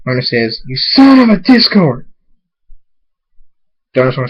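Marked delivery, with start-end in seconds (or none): 0.7-2.1 loud, high outraged curse; angry shout. A narrator's reading. Hunter cursing his captor, angry shout. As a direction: hurl it at your torturer.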